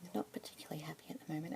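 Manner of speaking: soft unvoiced whisper throughout; asmr whisper. Keeper introducing a budgie to a listener, whispered. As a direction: whisper gently, close to the mic.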